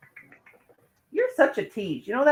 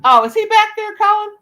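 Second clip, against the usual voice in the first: normal talking voice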